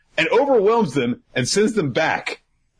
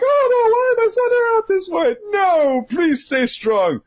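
hammed-up distress. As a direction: plead in a falsetto. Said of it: "And I was like".

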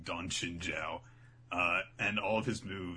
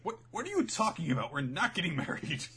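put-on confused young man; 9 semitones higher, 3 dB louder than usual. Sai confused at grandfather.

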